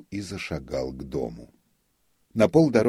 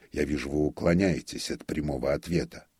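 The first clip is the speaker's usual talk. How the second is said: plain reading voice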